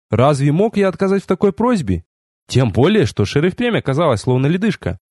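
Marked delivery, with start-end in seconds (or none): none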